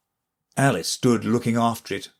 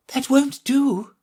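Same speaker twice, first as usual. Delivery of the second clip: high, light fawn voice; a gentle young fawn, much higher; 13 semitones higher, slower than usual. A narrator's reading. Fawn asking Alice to think again, high and light.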